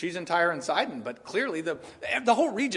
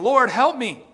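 desperate plea; 8 semitones higher, 10 dB louder, slower than usual. Acted. Woman begging Jesus for help.

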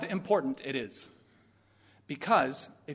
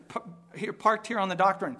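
ordinary talk, nothing marked

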